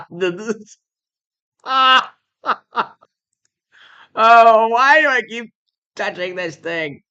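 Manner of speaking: high and loud, laughing so hard it almost sounds like crying; cry-laugh at his own mistake. Real, not acted. Laughing at himself.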